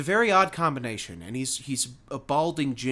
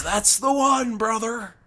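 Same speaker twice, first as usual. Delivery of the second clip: lightly imitated wrestler, pitched up in approval; Hulk Hogan; 6 semitones higher, 3 dB louder than usual. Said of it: "sounds like his voice is like Hulk Hogan".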